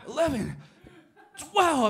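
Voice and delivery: rising high and loud with effort; straining. Retold or imitated. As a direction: push out each rep. Straining through reps.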